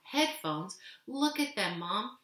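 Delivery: put-on indignant boy, understated; angry. A narrator's reading. Boy objecting to his mother about the headphones, mildly angry. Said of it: "I said angrily".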